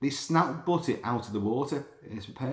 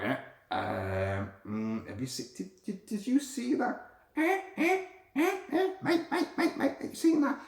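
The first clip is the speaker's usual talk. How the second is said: much higher, squawky gull voice nagging its mate; chattering gull; 13 semitones higher than usual. Pretend. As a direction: play a nosy seagull calling to its mate. Voicing gossiping gulls.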